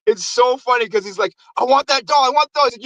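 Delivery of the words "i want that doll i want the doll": higher-pitched mock demanding; insistent demand. Mailman demanding the toy at the station, mock insistent. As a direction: demand it like a desperate rival.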